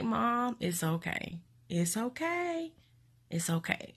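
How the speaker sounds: soft, quiet reassurance; calm her gently; gently comforting her mom on the phone; soothing. Retold or imitated. Soothing crying mother.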